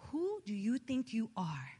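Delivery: slow, low mock indignation; understated retold affront. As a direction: imitate an offended singer, slowly and quietly. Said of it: "why she was so mad".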